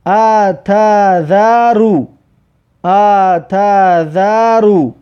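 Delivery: very slow, vowels held long; drawn-out model reading. Shown as a demonstration. Teacher models a word for learners, very slow and drawn out. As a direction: stretch "اتذرو" syllable by syllable.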